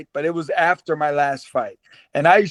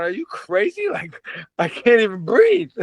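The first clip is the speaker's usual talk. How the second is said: incredulous inner voice; 4 semitones higher than usual. Retold or imitated. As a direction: retell a silent protest, pitch jumping up. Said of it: "in my head I'm going".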